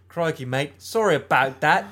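put-on Aussie accent; mock Australian. Co-host tries Australian next, mock Australian accent. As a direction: apologize as a cartoon Australian. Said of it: "How about Australian?".

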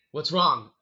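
re-enacted mom calling out suddenly; sudden loud question. Mom suddenly speaks, startling him.

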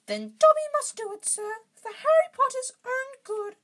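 very high, loud squeaky elf; high-pitched determined little creature; resolute house-elf. A narrator's reading. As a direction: announce it with regret in a piping elf voice.

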